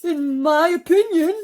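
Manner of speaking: shrill-free high worm voice; earthworm voice. Acted. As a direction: pitch way up for the worm.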